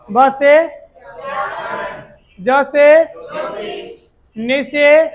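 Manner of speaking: ब्याजो called out loud, syllable by syllable; loud drill call-out. Shown as a demonstration. Teacher points at each letter for students, loud call-out. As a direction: call each cue loudly so the class answers. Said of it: "मैं जब इस पे उंगली रखूं".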